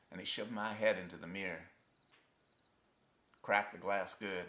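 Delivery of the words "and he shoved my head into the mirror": ordinary talk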